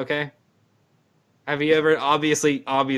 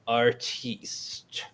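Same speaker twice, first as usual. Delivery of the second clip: pretentious artiste voice; much slower than usual. Retold or imitated. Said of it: "You know, like".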